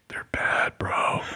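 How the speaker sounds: hushed, impressed aside; awed whisper. Retold or imitated. Guy beside him at screening, awed whisper. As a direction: lean in and whisper it like insider news.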